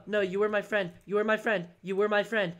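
ordinary delivery

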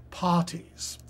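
usual speaking voice

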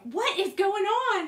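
high, loud and slow alarm; a mother startled by screaming. Retold or imitated. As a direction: demand to know what's happening. Mom hears screaming from bathroom.

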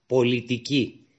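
slow, careful dictation of one word; each syllable drawn out for a learner; dictating slowly. Acted. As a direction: spell it out slowly for her to write. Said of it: "Λέγε μου τώρα τις λέξεις μία-μία".

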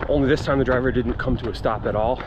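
usual speaking voice

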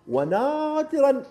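high, slow, drawn-out ونادرًا; emphatic rarely. Real, not acted. Driving coach stresses how rare it is, drawn out. Stretched emphatic word in advice.

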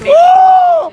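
sudden high shriek of surprise; shocked shriek. Real, not acted. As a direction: squeal in shock. Shocked at price.

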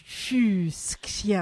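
Fuxian spelled out very slowly, syllable by syllable; careful Fuxian. Shown as a demonstration. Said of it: "F U".

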